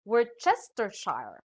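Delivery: Worcestershire as wor-CHESTER-shire; Worcestershire misread as word-chester-shy-er; mispronounced word. Shown as a demonstration. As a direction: say Worcestershire with CH and a final shire like fire. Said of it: "Some may read this as".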